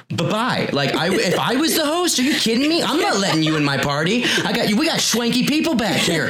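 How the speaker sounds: indignant, high-pitched host throwing someone out; upset party host. Acted. Party host ejecting a fly, upset. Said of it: "that's [name] getting upset as the host".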